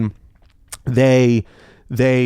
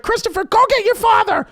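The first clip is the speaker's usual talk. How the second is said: very high-pitched imitation of a yelling mom; screaming mother. Mother calls son to get his father, screaming. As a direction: scream for help in a mock mother's voice. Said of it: "I hear my mother screaming".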